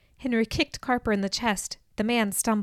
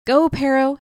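urgent shout, louder and higher; 5 semitones higher, 9 dB louder than usual. A narrator's reading. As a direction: yell it to send her off. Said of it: "Henry and Jimmy shouted".

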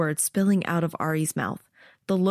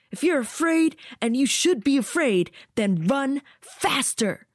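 screaming; 8 semitones higher than usual. Acted. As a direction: scream it in her face. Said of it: "he screams in her face".

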